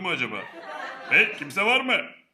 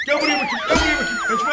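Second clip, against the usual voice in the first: high, fast, loud angry yell; angry chase; 5 dB louder, faster than usual. Acted. Chasing the cat, yelling fast and furious. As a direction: bark it out while chasing.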